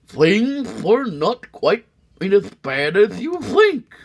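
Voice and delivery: wink through the pitch; sly salesman. Acted. McBean luring the sneetches back, high sly salesman voice. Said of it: "he with a sly wink".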